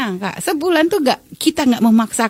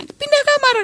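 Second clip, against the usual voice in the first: a roommate telling her to move rooms, raised high; quoted command. Retold or imitated. Friend tells Umi to move out, quoted high and firm.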